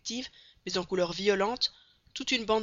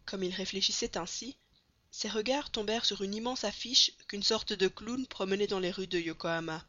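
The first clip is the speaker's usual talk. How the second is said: ordinary delivery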